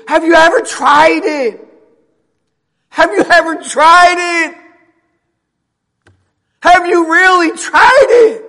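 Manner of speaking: high, loud, insistent shout; shouted preaching challenge. Real, not acted. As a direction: hammer the question at full voice. Preaches at full force.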